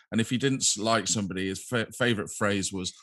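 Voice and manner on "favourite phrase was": ordinary delivery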